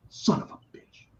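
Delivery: a frustrated hiss to yourself; whispered curse. Retold or imitated. Passed over for the question, whispered curse.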